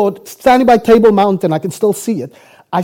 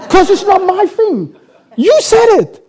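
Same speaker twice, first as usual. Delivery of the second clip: much higher, acted exasperated outburst; indignant protest; 9 semitones higher, 5 dB louder than usual. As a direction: throw the blame back in mock outrage.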